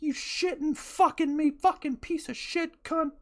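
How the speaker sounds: high, strained mock yelling of jumbled curses; half-shouted nonsense insults at another driver; retold road rage. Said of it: "I was just like".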